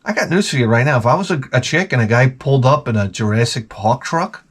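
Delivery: normal talking voice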